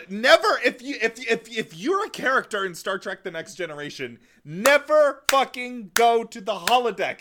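"never" pitched high and loud; emphatic warning. Real, not acted. Fan warning characters off the holodeck, "never" high and forceful. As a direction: plead it loudly, punching "never".

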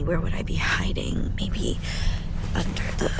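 hushed whisper while searching; breathy secret whisper. Acted. Searching for hidden money.

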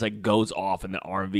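ordinary talk, nothing marked

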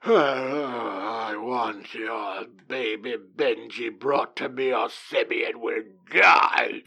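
low, slow, creepy villain threat; hushed creepy villain demanding the baby. Retold or imitated. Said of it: "the creepy manager's like".

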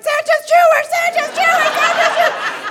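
very high mock-child scream; high-pitched hysterical chant; frantic repeated shrieking. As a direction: shriek it over and over like an overwhelmed kid. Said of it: "so I just kept repeating it".

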